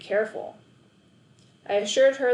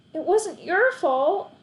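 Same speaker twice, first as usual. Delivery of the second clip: high, slow squeak; boy squeaking reassurance to the neighbour; 10 semitones higher, 5 dB louder, slower than usual. A narrator's reading. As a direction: squeeze it out high and tight. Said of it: "I squeaked".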